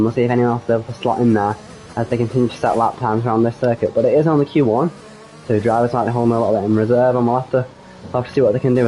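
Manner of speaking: ordinary talk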